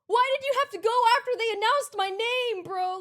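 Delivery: loud, high, quick complaint; mock exasperation. Speaker to the one who walked on too soon, mock exasperated. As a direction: complain in disbelief, high and fast.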